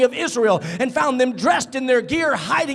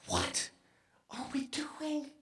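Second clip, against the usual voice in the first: hushed question; 3 semitones higher, 16 dB quieter, slower than usual. Real, not acted. Prays quietly, then repeats aloud.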